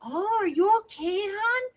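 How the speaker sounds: high, lightly voiced caring cashier; a hint of a fussing lady at the register; concerned cashier. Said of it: "she goes".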